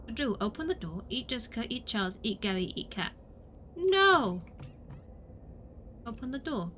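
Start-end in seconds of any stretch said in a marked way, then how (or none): 3.7-4.4 high, loud outcry; sudden playful wail; dismayed shout. Pretend. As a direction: cry out in protest. Outburst while playing.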